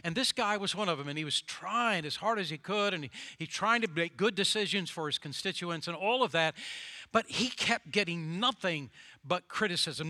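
ordinary talk, nothing marked

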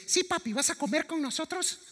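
voz mucho más aguda de niño que pregunta; voz de niño pequeño. Retold or imitated. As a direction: imita a un niño de tres años, mucho más agudo. Voz del hijo de tres años. (hijo de tres años le pregunta a papá, voz aguda)

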